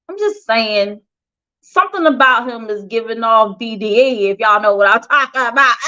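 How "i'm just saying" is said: muttered aside. Real, not acted. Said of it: "That's what I'm just saying".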